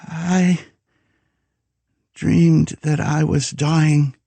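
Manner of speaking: high, slow frightened woman's voice; a young woman waking from a dying dream, slowly. A narrator's reading. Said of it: "she said".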